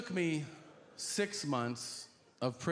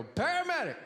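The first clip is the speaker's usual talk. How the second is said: excited; 12 semitones higher than usual. Retold or imitated. Excited caller in story.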